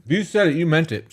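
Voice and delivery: talking with food in mouth; chewing while talking; mouth full. Real, not acted. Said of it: "Sorry, I have food in my mouth".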